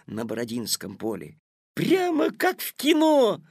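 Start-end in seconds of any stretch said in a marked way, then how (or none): 1.7-3.4 put-on high, loud boyish delight; delighted exclamation. A narrator's reading. Petya marvelling at history flying past, enthusiastic. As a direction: exclaim it with rapture, pitch leaping up. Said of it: "восторженно воскликнул Петя".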